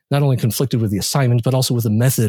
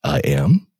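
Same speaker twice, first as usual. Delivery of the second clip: surprised; 4 semitones higher than usual. Acted. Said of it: "said the voice in a surprised tone".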